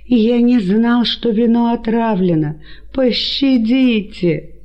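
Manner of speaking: put-on old man begging for his life; pitiful pleading. A narrator's reading. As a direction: plead for mercy, drawn out. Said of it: "жалобно простонал Геркан".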